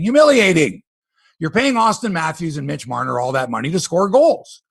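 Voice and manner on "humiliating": Humiliating said higher and louder, hit hard; correcting disappointing to Humiliating, forcefully; emphatic correction. Real, not acted. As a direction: punch out Humiliating with disgust. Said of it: "No, not disappointing".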